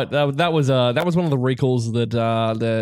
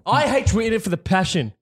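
higher, heated mock rant; impassioned; 7 semitones higher than usual. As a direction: rant like it's personal.

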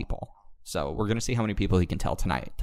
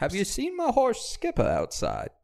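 put-on southern drunk Paul Revere; 13 semitones higher than usual.

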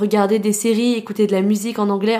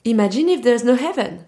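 prof qui insiste. Retold or imitated. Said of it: "Il était en mode".

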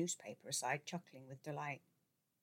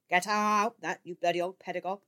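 gruff greeting; 3 semitones higher, 8 dB louder than usual. A narrator's reading. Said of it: "the editor said in recognition".

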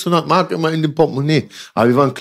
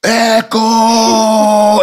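long shouted name, higher and louder; yell; 7 semitones higher, 4 dB louder than usual. Retold or imitated. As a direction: yell it across the schoolyard. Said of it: "Der Hausmeister schreit".

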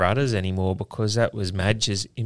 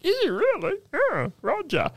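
much higher, silly put-on voice of a fooled mate; mock gullible friend. Teasing co-host mimics his friend being fooled, high mock voice. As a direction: squeak it like a mate falling for the joke. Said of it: "You were trying to think".

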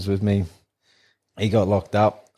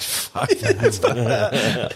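slow winded groan; 3 semitones higher, much slower than usual. Retold or imitated. Winded by bull's kick.

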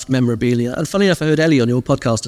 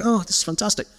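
mock delight; 7 semitones higher, faster than usual. Retold own delighted reaction.

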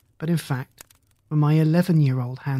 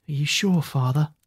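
ordinary delivery, nothing marked

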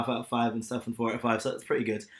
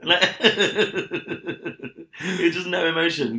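laughing; 5 semitones higher, 3 dB louder, much slower than usual. Real, not acted. Laughing while mocking the actor.

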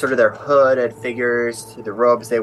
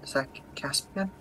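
hushed, halting question; hushed shock; 3 semitones higher, 17 dB quieter than usual. Acted. Sister finding Caspian's dead body, hushed shock. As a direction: ask softly, faltering, as if afraid of the answer. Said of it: "looking at Caspian's dead body".

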